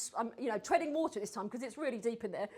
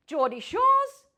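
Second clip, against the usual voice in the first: a hint of Geordie, higher and louder; Geordie accent; 12 semitones higher, 11 dB louder than usual. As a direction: lightly mimic a Geordie TV star. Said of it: "I'll just copy her voice".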